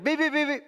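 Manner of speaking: high, rapid-fire beeping; imitated detector beeps. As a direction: mimic a security scanner alarm. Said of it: "and is like".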